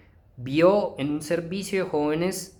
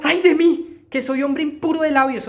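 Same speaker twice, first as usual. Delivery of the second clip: loud, high anguished cry; Isaiah's cry of woe; 8 semitones higher, 4 dB louder than usual. Acted. Isaiah before God's throne, crying out in loud anguish.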